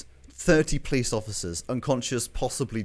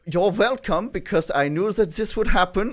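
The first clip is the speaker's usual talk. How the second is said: put-on raised, accented koala villain voice; theatrical koala character; 5 semitones higher than usual. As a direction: plead your case in a put-on accent. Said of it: "and who says".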